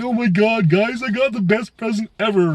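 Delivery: high-pitched, gushing mock excitement; kid on Christmas. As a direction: gush like a kid with the best present. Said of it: "I was a kid on fucking Christmas".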